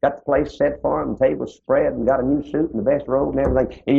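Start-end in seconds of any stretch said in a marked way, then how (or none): none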